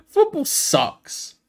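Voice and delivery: slow, high laughing complaint; laughing, drawn out. Real, not acted. Fan laughing in despair at her team to viewers. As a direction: let the laugh carry the whole line slowly.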